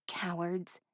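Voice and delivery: bitter thought. A narrator's reading.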